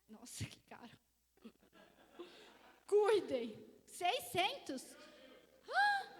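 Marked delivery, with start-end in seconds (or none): 0.1-1.0 whispered aside. Pretend. Someone whispering about the microphone's price. 5.7-6.1 high, loud gasp of shock; a sudden yelp at the price; shocked cry. Pretend. Reacting to a six-hundred-real microphone with a shocked cry.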